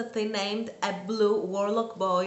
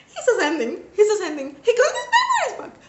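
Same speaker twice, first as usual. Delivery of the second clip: loud, high, laughing squeal of joy; ecstatic; 11 semitones higher, 8 dB louder, faster than usual. Retold or imitated. Retelling reading the book, a loud laughing squeal. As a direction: shriek it out in delight, laughing.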